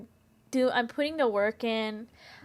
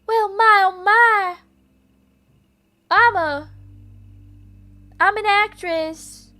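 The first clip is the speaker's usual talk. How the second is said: hammed-up Mid-Atlantic film actress, higher and louder; Mid-Atlantic accent; 9 semitones higher, 8 dB louder, slower than usual. Streamer imitating a fifties actress in Mid-Atlantic accent. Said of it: "the Atlantic accent".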